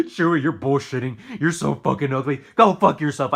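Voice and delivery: loud scornful laugh then a sneering put-down; mocking girl. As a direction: jeer at him like a girl brushing him off. Girls mocking him, voiced loud.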